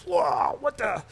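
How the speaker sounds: high-pitched startled outburst; mock shocked reaction. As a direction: recoil from an awful drink, hammed-up. Acts out drinking spiked lemonade.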